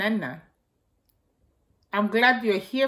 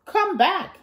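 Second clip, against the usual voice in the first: calling; 8 semitones higher than usual. A narrator's reading. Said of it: "they called".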